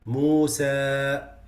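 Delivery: Musa with a clear, loud open alif; correct Musa. Shown as a demonstration. Teacher demonstrating the right Musa to students. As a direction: sound the alif in Musa fully. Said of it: "like this".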